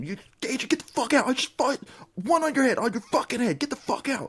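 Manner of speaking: high mock yell; retold yell. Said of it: "I'm fucking yelling at him".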